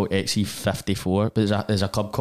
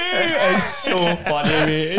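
laughing; 8 semitones higher, much slower than usual. Real, not acted. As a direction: stretch "It's so funny" through the laugh. Laughing at brother's video.

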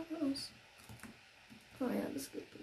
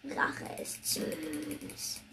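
villainous whisper; 2 semitones higher, 3 dB quieter, much slower than usual. Pretend. Revenge on mob, dramatic.

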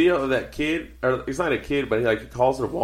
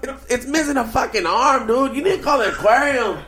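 mock Spanish accent; 13 semitones higher than usual. Calling Jay over to see a fish, mock Spanish accent. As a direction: play an amazed guy with a Spanish accent, voice way up. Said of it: "you make him sound Spanish".

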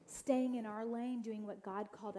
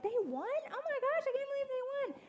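amazed; 5 dB louder than usual. Retold or imitated. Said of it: "we're like".

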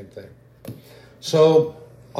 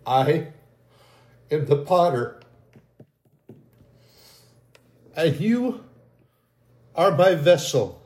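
higher, ringing prophetic proclamation; God telling believers they are forged in fire, proclaimed higher; 4 semitones higher, slower than usual. Acted. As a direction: speak as God blessing his people, rising. Prophetic word in God's voice.

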